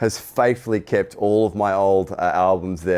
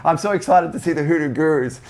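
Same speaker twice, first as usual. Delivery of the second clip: higher, excited mock Brazilian accent; bad Brazilian accent; 6 semitones higher than usual. Musician quoting the fan before the show, bad Brazilian accent. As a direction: badly imitate a thrilled Brazilian fan. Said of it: "trying to do a bad Brazilian accent".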